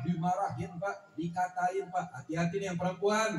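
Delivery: usual speaking voice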